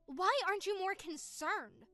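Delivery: indignant sister heard through a phone; upset, over the phone. Acted. Sister confronting her brother about Dad, upset on the phone. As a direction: push back, shocked, down the line.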